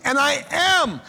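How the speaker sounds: "I am" lifted high with weight; rising, pressed "and I am"; stress on am. Shown as a demonstration. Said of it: "present tense".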